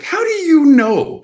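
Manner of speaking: high, loud mock-exasperated challenge; incredulous. Actor answering producers who guess what a star would like, incredulous. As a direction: squeal it in comic disbelief. Same acted reply to producers.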